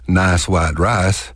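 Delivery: slow Texas "nahs waht rahs"; strong Texas accent. Shown as a demonstration. Showing listeners how Texans talk, strong Texas accent. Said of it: "you have a strong Texas accent".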